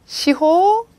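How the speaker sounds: teacher's careful "시호", last vowel held; Japanese reading demonstrated. Shown as a demonstration. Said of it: "이렇게 읽어주세요".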